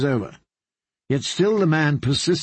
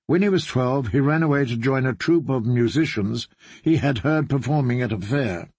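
usual speaking voice

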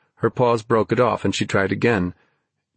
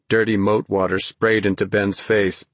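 plain reading voice

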